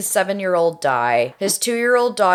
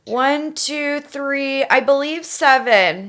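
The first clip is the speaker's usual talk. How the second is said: put-on high, pleading frightened girl; 911 caller; 7 semitones higher, slower than usual. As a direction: beg for help, panicked. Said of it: "I am going to be Melissa".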